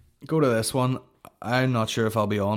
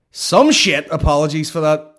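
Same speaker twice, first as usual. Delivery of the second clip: loud apology; 5 semitones higher, 12 dB louder than usual. Real, not acted. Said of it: "Apologies for talking mad shit".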